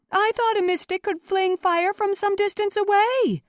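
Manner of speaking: very high, loud challenge; heckler calling out. A narrator's reading. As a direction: call out doubtfully from the crowd. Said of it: "someone called out".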